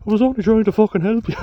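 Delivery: high, loud and fast, a wronged lad whining; mock wounded yelp. Buster after the punch, high loud indignant yelp. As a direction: squeal it out like a hurt helper. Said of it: "and he was like".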